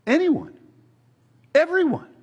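"anyone" and "everyone" lifted high and loud; soaring emphasis. Real, not acted. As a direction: ring out "everyone" like good news. Preacher stressing universal claim.

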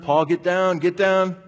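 put-on loud, high call to a running child; urgent shout. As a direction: shout him down off the ties. Yelling at running kid.

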